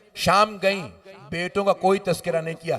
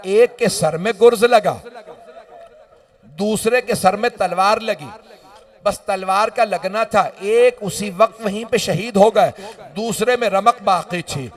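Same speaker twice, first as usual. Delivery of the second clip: ordinary delivery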